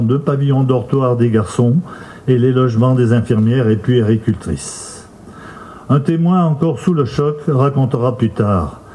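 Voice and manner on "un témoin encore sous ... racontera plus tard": ordinary delivery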